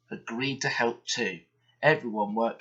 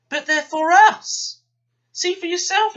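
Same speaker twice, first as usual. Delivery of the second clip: loud, high, urgent protest; exclaiming; 10 dB louder than usual. A narrator's reading. Driver protesting to the foreman, loud and high. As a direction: insist hard, pushing the label at him. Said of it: "exclaimed Douglas's driver".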